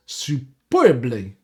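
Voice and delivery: stretched-out, oddly accented SUPERBLY; drawn-out word in a strange accent; odd accidental accent. Real, not acted. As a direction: slip into an unplaceable accent on "superbly". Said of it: "Don't know what that accent was".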